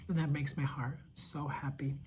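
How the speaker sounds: soft heartfelt whisper. Real, not acted. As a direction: whisper it tenderly. Tender aside about joy.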